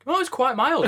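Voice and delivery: bright lifted "quite mild"; pleased little lift; mildly delighted "quite mild"; a bit delighted. Shown as a demonstration. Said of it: "he's a bit delighted".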